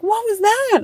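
put-on high, baffled little boy; lightly voiced startled child, way up; stunned kid. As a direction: son asking what just happened. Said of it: "he's like".

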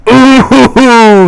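high, loud teasing ooh with a laugh; the room's ooh at a burn; mocking ooh. Crowd reacting to roast.

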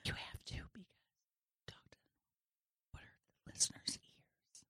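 barely audible whisper. Real, not acted. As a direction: whisper back as softly as you can. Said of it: "automatically starts to whisper".

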